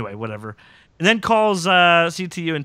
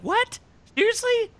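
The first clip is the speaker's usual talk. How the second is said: high-pitched, exaggerated disbelief; incredulous. Host retells their reaction to the episode, incredulous. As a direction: squeal it as a mock shocked reaction.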